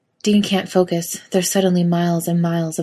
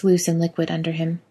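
plain reading voice

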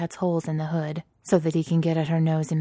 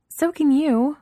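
retort; 11 semitones higher, 8 dB louder, slower than usual. A narrator's reading. Amanda retorts in banter.

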